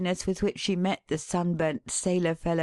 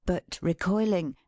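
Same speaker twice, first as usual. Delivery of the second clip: plain reading voice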